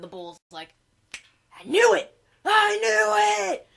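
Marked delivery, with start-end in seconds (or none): 1.6-3.7 high, loud and drawn-out, slowing; triumphant roar. Retold or imitated. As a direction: gloat it at full volume. Bull triumphantly recognising unicorn.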